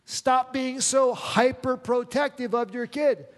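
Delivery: a son telling his mom to ease off, loud and fed up; loud exasperated "Mom, give it a break"; pleading with mom. Retold or imitated. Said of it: "I'm like".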